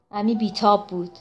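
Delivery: plain reading voice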